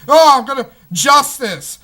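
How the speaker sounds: sarcastic noble hero impression; mock hero voice. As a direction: ham up a self-righteous lawman. Mocking the Lone Ranger's righteousness.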